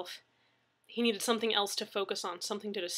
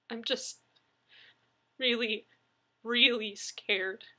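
scared, near tears; 3 semitones higher, slower than usual. Acted. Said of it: "trying not to cry again".

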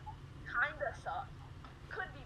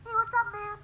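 put-on high kid voice changer; 9 semitones higher, 5 dB louder than usual. Shown as a demonstration. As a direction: chirp it like a little kid. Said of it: "I was doing this".

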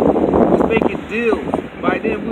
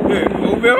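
teasing call; 12 semitones higher than usual. Real, not acted. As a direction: sing it out high, teasing him. Offering to an old man, teasing.

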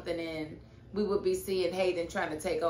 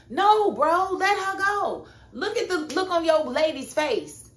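high, loud exasperated rant; loud worked-up scolding; outraged rant; 7 semitones higher, 6 dB louder than usual. Real, not acted. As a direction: scold him through the screen. Ranting at Zach on TV.

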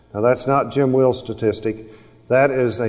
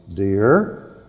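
ordinary talk

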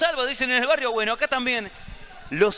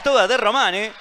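usual speaking voice

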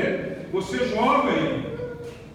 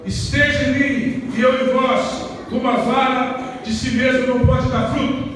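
preaching scripture; 4 semitones higher, 6 dB louder than usual. Acted. Scripture declaimed.

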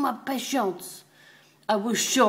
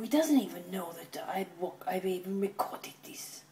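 soft hushed aside; whisper; 2 semitones lower, 9 dB quieter than usual. Real, not acted. Owner whispering about catching the dog. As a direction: keep it secret, whisper it.

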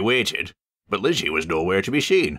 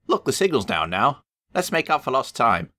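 bright guard voice; Lizzie's voice; 8 semitones higher than usual. A narrator's reading. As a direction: cheer them on kindly.

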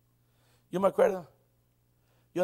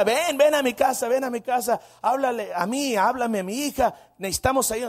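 higher, louder imploring mother; urgent pleading; 4 semitones higher, 7 dB louder than usual. Retold or imitated. Rich mother begs preacher to help her daughter, urgent pleading. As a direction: plead loudly, beckoning him over.

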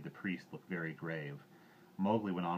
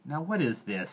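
raised, louder puzzled question; higher-pitched bewilderment; bewildered; 8 semitones higher, 7 dB louder than usual. A narrator's reading. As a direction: ask it in startled confusion.

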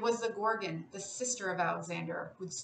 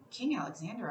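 usual speaking voice

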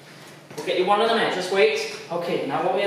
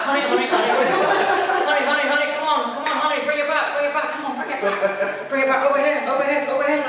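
high dog calling; 7 semitones higher than usual. Real, not acted. Calling dog back, laughing.

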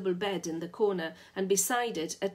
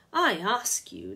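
mock shriek; 5 dB louder than usual. A narrator's reading. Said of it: "Lou shrieked".

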